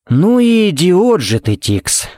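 drawn-out high start, dropping on the name; exasperated verdict. A narrator's reading. Jared sums up Tix's blunder, exasperated. Jared scolds Tix after quarrel.

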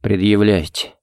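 faint, defeated near-whisper. A narrator's reading. Кевин answering the charge, faint whisper. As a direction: let it out faintly. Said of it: "прошептал затем".

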